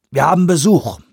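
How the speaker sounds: sharp, loud bark at the room. A narrator's reading. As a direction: bark it out to everyone. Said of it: "bellte er in die Runde".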